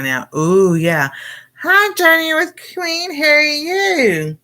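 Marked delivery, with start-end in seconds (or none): 1.6-4.4 high, bright singsong hello; light up and sing out a hello; cheery greeting. Real, not acted. Greeting a live-chat viewer by name, high sing-song hello.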